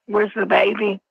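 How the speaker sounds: ordinary talk, nothing marked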